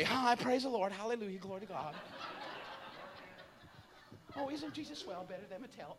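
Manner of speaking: dainty, hushed fairy-like churchgoer. Retold or imitated. Mocking prim believers, preacher minces softly like a fairy. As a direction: tiptoe through it like a little fairy. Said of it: "walk around like Tinkerbell, like a little fairy".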